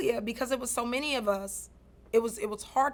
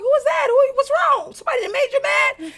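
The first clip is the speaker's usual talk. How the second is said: much higher and louder, alarmed and protective; godmom shouting; 9 dB louder than usual. Retold or imitated. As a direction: shout it like a worried godmother ready to fight. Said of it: "my godmom was like".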